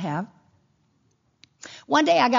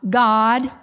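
emphatic proclamation; 6 dB louder than usual. Real, not acted.

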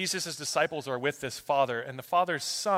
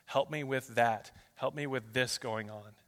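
low, soft, murmured prayer list; soft prayer, dropping low; understated prayer; 5 semitones lower, 7 dB quieter than usual. Retold or imitated. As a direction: quietly recite your requests to God. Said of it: "prayers that I write down".

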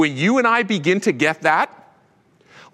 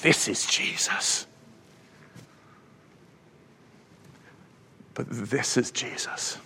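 near-whisper, much softer; hushed reverence; 3 semitones lower, 10 dB quieter than usual. Real, not acted. Preacher to congregation on Jesus' glory, hushed whisper. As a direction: breathe it out in awe.

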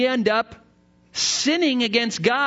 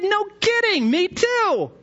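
much higher, thrilled; eager delight; 11 semitones higher than usual. Acted. Joke narrator thrilled to meet a fellow Baptist, much higher.

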